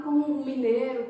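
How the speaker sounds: normal talking voice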